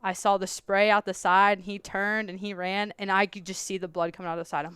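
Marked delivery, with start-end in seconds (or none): none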